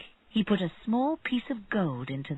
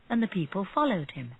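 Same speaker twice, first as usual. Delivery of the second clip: ordinary delivery, nothing marked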